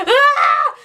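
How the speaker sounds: high, loud hammed-up crying wail; mock wail. Imitating Chrissy's wail in the Tokyo room, hammed-up. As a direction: let out a fake sobbing shout. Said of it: "She is crying".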